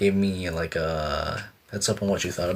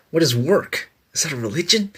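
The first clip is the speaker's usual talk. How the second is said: higher, louder mock-philosophical question; mock puzzled; 6 semitones higher, 3 dB louder than usual. Streamer joking to chat about work, mock puzzled. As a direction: ponder like a fake philosopher.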